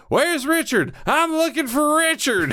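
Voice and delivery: high, mock-serious demand; a lightly voiced tough-guy demand; Locke's entrance. Locke walking into camp asking for Richard, high and mock-serious. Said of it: "when he's like".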